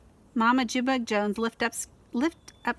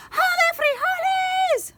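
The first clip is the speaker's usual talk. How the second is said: much higher, louder, slow shouted war cry; battle cry; 7 dB louder, slower than usual. Acted. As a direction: roar it as you thrust the sword. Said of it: "cried Scapito as he thrust his sword".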